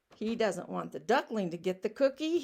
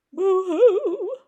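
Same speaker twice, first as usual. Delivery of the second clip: mock boo-hoo sobbing; 11 semitones higher, 12 dB louder than usual. Mocking a tantrum.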